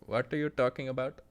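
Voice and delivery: put-on Indian accent. Shown as a demonstration. Streamer answering a chat request, Indian accent. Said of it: "Can you do an Indian accent?".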